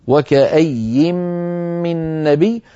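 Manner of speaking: وكأي read on through to من نبي, drawn out; slow tajwid recitation of وكأي من نبي; joined reading. Shown as a demonstration. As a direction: recite وكأي joined to the next words, slowly. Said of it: "فنلفظه وصلا".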